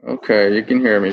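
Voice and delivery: muffled through a face mask; talking through a face mask. Real, not acted. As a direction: speak from behind a mask. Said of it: "hear me okay through this mask".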